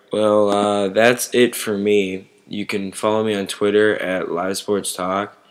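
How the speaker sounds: normal talking voice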